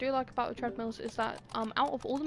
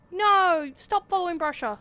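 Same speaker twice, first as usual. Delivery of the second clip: loud urgent shout; yelling at a game character to stop; frustrated yell; 5 semitones higher, 9 dB louder than usual. Real, not acted.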